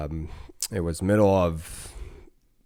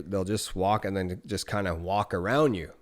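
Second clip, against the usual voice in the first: normal talking voice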